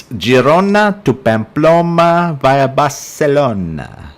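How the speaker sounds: slow, showy go at saying "Girona to Pamplona via Barcelona" like a local; attempted local pronunciation. Real, not acted. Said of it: "Did I get those pronunciations vaguely correct?".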